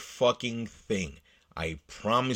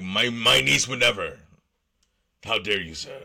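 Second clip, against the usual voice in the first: hammed-up indignant uncle, louder; mock offended; 4 dB louder than usual. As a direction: clutch your pearls, how dare you sir. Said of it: "the man replied in an offended voice".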